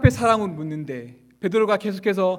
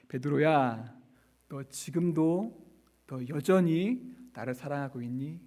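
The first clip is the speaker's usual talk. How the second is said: softer, slow gentle asking; tender question; 4 semitones lower, 6 dB quieter than usual. Acted. Jesus softly asking Peter about his love, tender. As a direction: lean in and ask kindly. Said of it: "한 가지를 예수님이 물으시는 것이죠".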